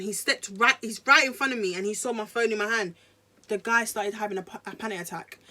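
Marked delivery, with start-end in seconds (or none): none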